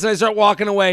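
ordinary talk, nothing marked

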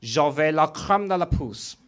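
slow, put-on French accent; mock French. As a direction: draw it out in fake French. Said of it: "This is how I say vagina in French".

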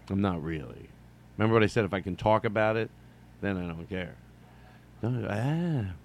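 ordinary talk

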